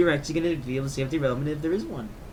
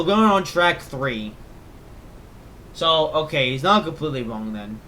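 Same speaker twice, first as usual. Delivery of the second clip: ordinary talk, nothing marked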